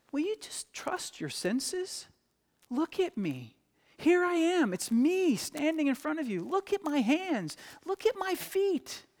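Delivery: soft, high, tender coaxing; very gentle. Retold or imitated. Jesus reassures doubting disciples, very gently. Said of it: "But Jesus very gently says".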